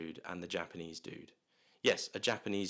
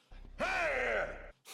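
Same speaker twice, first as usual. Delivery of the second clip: shrill burst of laughter, very high; high shrieking laugh. Real, not acted. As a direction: let out a squealing laugh. Reacts to Hopkins dying.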